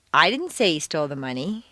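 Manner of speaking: "I" emphasized, rest of sentence lighter; stress on I. Shown as a demonstration. Teacher showing someone else said it, stress on "I". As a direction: punch "I" to deny being the one. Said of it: "Someone else said it".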